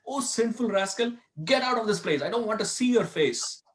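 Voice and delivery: half-acted angry dismissal of the disciple; furious guru. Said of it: "Madhavendra Puri got furious, very angry at him".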